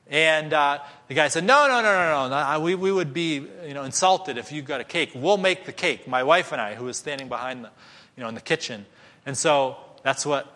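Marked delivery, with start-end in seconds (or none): 1.4-6.7 loud, high protesting no's; louder, higher insistent refusal; insistent cook. Retold or imitated. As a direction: wave off the offer, insulted. Cook refuses offer loudly.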